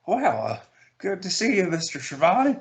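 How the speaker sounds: high-pitched J R voice; lightly voiced J R; J R impression. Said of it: "well, I can't do J R's voice".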